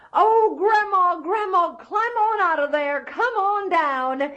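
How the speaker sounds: put-on high, loud boy's call. Jack calls Grandma to climb out, high and loud. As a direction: coax her down, shouting up.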